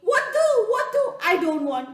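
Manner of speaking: high, loud, fast panicked yelling; panicked scream. Acted. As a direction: shriek while backing away. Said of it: "he screamed in Telugu".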